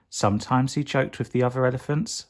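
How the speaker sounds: usual speaking voice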